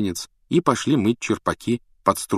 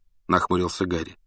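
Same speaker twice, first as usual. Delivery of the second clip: ordinary delivery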